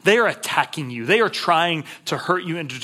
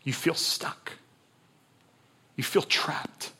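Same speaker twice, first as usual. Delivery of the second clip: hushed whisper, softer; 8 dB quieter, slower than usual. Acted. Preacher to a hurting listener, whispered. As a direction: lean in and breathe it.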